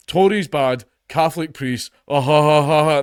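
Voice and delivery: mocking imitation. Comic mocking fellow comedians' same old jokes, mocking fake laugh. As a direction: parrot stale jokes, then force a hammed-up laugh.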